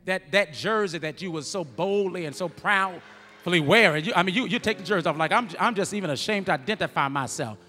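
loud, high, building preacher's cry; preaching at full force. Acted. Preacher to the congregation about fair-weather fans, full-force preaching. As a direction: drive it home to the crowd, rising to the climax.

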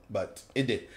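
normal talking voice

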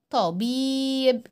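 طبيب with a held vowel; long ii in طبيب. Shown as a demonstration. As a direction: stretch the ii of طبيب. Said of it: "ونطول الصوت قوي".

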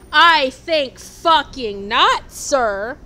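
loud, slow, drawn-out sarcastic denial; mock indignation. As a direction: stretch it out like a haughty retort. Mock indignant reply about profanity.

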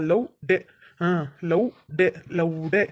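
"ಲವ್ ಡೇ" low, split into two separate words, love, day; careful pronunciation. Acted. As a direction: spell out "ಲವ್ ಡೇ" with a pause between love and day. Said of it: "ಲೌಡೆ ನನ್ ಮಗ ಅಂದ್ರೆ ಬೇರೆ ಬರುತ್ತೆ ಅರ್ಥ".

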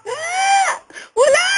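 very high squealed protest; mock agonised cry. Whipped medium answers healer, mock agonised squeal. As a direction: wail a denial as if whipped.

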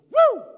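high, piercing celebratory "woo"; loud whoop. Retold or imitated. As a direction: let out a big high holler. Retold man's whoop on arrival.

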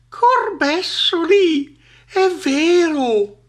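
high, loud, drawn-out snake cry; shocked exclamation. A narrator's reading. Snake sees the monster is real, shocked exclaiming. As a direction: stretch out the horrified discovery. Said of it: "esclamò la biscia".

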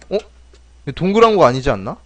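usual speaking voice

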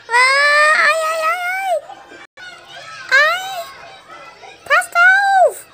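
high cries of pain; tripping and falling. Acted. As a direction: yelp as you fall and hurt your foot. Child falls, cries in pain.

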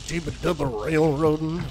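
slow, drawling drifter mumble; hobo voice. Retold or imitated. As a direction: ramble it like an old hobo. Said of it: "my impression of a hobo".

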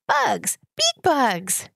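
put-on high, bright little girl shouting with delight; excited toddler cry. A narrator's reading. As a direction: squeal it like a thrilled toddler. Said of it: "she cried".

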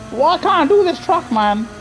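high-pitched exasperated complaint; real gamer's whine of frustration; frustrated. Real, not acted. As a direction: plead with yourself over your bad driving. Frustrated at own driving mistakes.

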